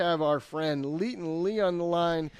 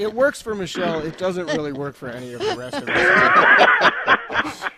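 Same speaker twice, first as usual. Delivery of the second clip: laughing; 3 semitones higher, 10 dB louder than usual. Real, not acted. Host laughing while joking.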